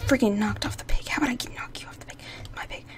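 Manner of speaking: fast unvoiced seething; whispered anger. Real, not acted. Gamer seething at the player who knocked her off, whispered. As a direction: hiss it under your breath.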